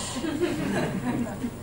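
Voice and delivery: mother's laughter fading out; laughing. Retold or imitated. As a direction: echo her laughter softly. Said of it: "She cracked up".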